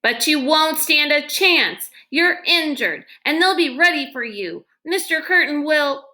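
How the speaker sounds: put-on girl begging the injured man not to go back, distraught. A narrator's reading. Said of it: "The distraught girl was interrupted".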